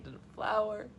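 soft, halting, choked-up retelling; hammed-up weepy fragments; mock hysterical. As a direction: falter through it as if overcome. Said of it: "I was hysterical during E T".

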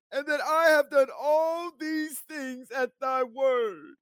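high, drawn-out ringing proclamation; sustained high preacher's declamation; full-force scripture preaching. Acted. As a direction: cry out the prayer to a crowd, slowly.

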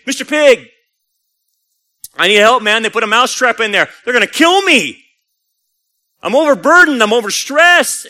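high, loud, hammed-up panic; frantic plea. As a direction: cry out for help as a desperate storybook mouse. Panicked mouse begs pig for help.

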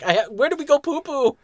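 mock henchman. Host quoting a cartoon henchman, hammed-up whiny voice. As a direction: play the helpless goon asking a silly question. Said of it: "one of the henchmen in there is like".

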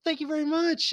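high, loud burst of thanks; grateful beggar. Acted. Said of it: "He's like".

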